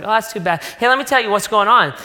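oblivious excited chatter, barely listening; self-absorbed gush. Retold or imitated. Husband on the phone, bragging to his exhausted wife, excited. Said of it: "I was like".

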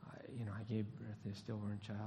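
a hint of a mother numb with grief; soft grieving mother. Grieving mother answers a visitor, soft and rushed.

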